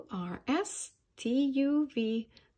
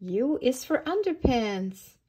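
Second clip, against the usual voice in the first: usual speaking voice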